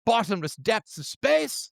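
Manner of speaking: high-pitched, put-on British apology; mock British accent, high and breezily apologetic. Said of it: "maybe with a British accent".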